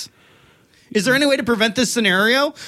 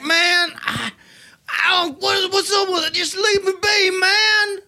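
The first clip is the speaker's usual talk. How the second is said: higher, shouted mock exasperation; mock irritated outburst; 9 semitones higher, slower than usual. Mocking Dalton, a high shouted mock brush-off. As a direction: snap back like a moody friend. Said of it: "You're like".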